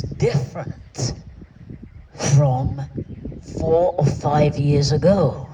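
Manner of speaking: full-voiced emphatic line; emphatic statement. Repeated phrase, first take.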